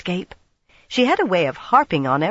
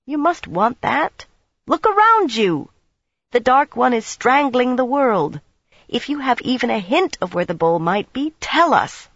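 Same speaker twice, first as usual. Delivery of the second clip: high, loud, barely held anger; rising anger; 8 semitones higher, 3 dB louder than usual. Acted. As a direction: let the anger break through. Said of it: "anger oozed up despite her".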